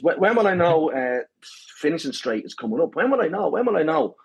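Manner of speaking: mock a runner's repeated high questions; pestering questioner. Runner asks the pacer over and over, mock pestering. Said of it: "after asking me about twenty times".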